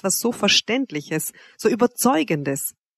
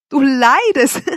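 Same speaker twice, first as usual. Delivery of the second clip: loud, high, incredulous, bursting into laughter; mocking laughter; 8 semitones higher, 6 dB louder, slower than usual. A narrator's reading. Wanda mocking Severin's suffering, laughing. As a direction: exclaim it loudly, on the edge of laughing. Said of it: "brach in ein lautes, mutwilliges Lachen aus".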